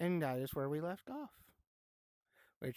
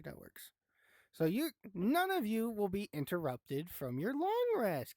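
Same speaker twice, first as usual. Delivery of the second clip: normal talking voice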